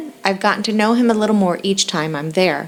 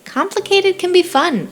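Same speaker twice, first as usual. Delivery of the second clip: higher, sly and teasing; devious smile; 12 semitones higher than usual. Acted. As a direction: tease him with a sly grin. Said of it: "with a devious smile".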